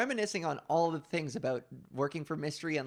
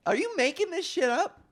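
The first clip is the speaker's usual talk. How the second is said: incredulous audience; 12 semitones higher, 5 dB louder than usual. Retold or imitated. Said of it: "people are watching this like".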